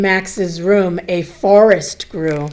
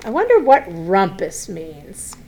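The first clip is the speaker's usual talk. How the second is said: ordinary delivery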